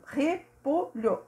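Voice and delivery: Repolho said slowly and drawn out; slow demo. Shown as a demonstration. Teacher sounding out the word while searching, slow. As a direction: stretch out Repolho. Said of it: "Deixa eu procurar repolho".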